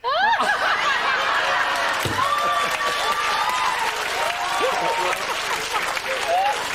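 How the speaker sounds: loud high shrieking laugh bursting out; big laugh. Real, not acted. Host shrieks with laughter at the guest's eight children. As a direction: let a huge laugh explode up high.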